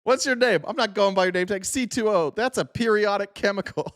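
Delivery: high-pitched mocking retelling; retelling the driver's jab at the officer, high and mocking; high mock quote. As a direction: squeak out the exchange like a silly parody. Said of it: "he's like".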